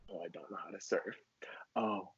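very soft, low, near-whispered confession; sheepish admission. Acted. New student admitting to classmates they can't surf, sheepish near-whisper. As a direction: shrink into a tiny embarrassed murmur. Acted sheepish answer at orientation.

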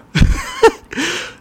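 a quick high chuckle; short laugh. Real, not acted. Reader laughing at his mistake, short laugh. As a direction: laugh off the slip.

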